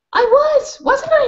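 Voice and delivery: very high, pleased squeak; proud dog voice; happy panting dog. Acted. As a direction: bubble it out proudly. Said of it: "panting happily".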